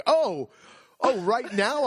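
high, loud surprised "oh"; taken aback. Retold or imitated. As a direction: react as if caught off guard. Asked to pay on the spot.

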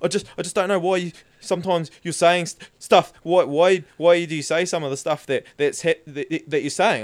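hesitant, stumbling questions; nervous stammering cop. Retold or imitated.

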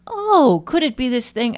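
high startled oh, then wondering; sudden dawning surprise; mock startled discovery; surprised realization. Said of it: "Finally, I was like".

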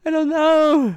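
high, loud hammed-up wail of despair; mock despair cry. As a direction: throw the line up in a hammy squeal. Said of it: "I don't know why I just said that".

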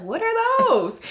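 high, loud puzzled question; clueless bewilderment. Retold or imitated. Student told about office hours by mentor, clueless bewilderment. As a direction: play up total bafflement at a new term.

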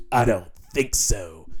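put-on low, slow French-accented hero line, half-joking; grovelly Christopher Lambert. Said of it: "That is my grovelly Christopher Lambert, uh, accent there".

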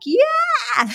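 high-pitched squealed yeah; excited. Retold or imitated. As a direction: re-enact an overjoyed yes, pitch leaping up. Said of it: "because I'm so excited about it".

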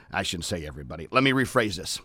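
soft unvoiced self-correction; whispered aside. Real, not acted. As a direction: drop to a whisper to correct yourself.

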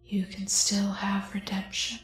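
eerie dissonant whisper. Acted. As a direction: breathe it like a ghostly voice. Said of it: "dissonant whisper in your head".

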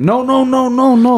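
high, emphatic denial; excited. Real, not acted. Excited denial about old phones.

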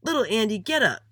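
high, loud stern command; yelled. A narrator's reading. Principal Thomas orders little Andy up, yelling. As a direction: yell the order from across the playground. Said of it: "yelled Principal Thomas".

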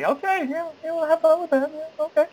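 high-pitched, hurried-sounding yeses; nervous agreement. Retold or imitated. Invited to audition by his friend, nervous agreement.

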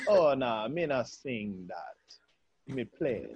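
put-on low, slow, soft Caribbean accent; deep-voiced Caribbean woman. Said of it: "she was very deep when she talked".

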